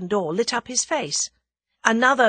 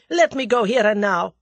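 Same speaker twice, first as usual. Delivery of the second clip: loud, raised-pitch angry demand; snarling demand; 4 semitones higher, 6 dB louder than usual. A narrator's reading. The Russian demands to get out, snarling. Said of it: "snarled the other".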